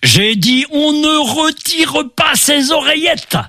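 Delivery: stern shouted order; shouting. Acted. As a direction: yell the rule at him. Ulysses shouts at sailor.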